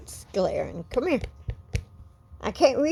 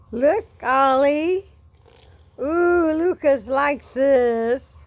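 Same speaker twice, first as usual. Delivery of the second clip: higher, louder, drawn-out cooing; excited pet talk; 5 semitones higher, 5 dB louder, slower than usual. Real, not acted. Owner showing Ollie that Lucas likes brushing, excited pet talk. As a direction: coax the pet with slow, loud delight.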